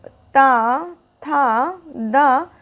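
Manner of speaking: ता, था, दा with the tongue on the teeth, ता drawn out; tongue against the teeth, slowly; slow dental drill. Shown as a demonstration. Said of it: "दंत इसका कैसे उच्चारण स्थान हुआ".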